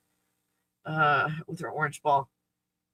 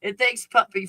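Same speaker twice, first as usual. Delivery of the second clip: ordinary talk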